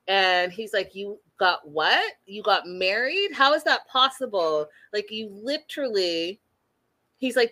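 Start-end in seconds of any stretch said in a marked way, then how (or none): none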